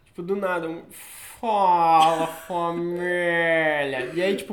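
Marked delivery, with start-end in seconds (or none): 1.4-4.1 loud, very slow stretched greeting; imitating his catchphrase. Friend imitating his greeting, in a stretched catchphrase voice. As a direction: copy his drawn-out call. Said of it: "Mas essa vozinha".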